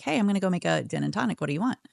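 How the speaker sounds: quick, breezy retold offer; lightly voiced friend offering a drink; casual offer.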